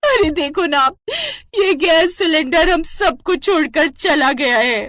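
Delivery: wife wailing to her husband about the cylinder, melodramatic; melodramatic crying. Acted. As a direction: mourn the cylinder as if it were a person. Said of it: "तुम इतना रोना धोना क्यों मचा रही हो".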